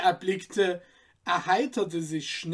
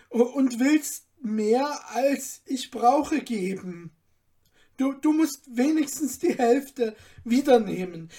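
higher and louder, moved to tears; tearful thanks; 5 semitones higher, 4 dB louder than usual. Acted. Student embracing Moses, moved thanks. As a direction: thank him with emotion breaking through.

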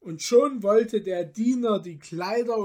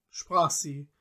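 ordinary delivery, nothing marked

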